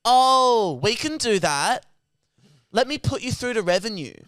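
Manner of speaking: mock over-eager helpfulness; sudden chirpy operator. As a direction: flip to fake cheerfulness. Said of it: "He goes".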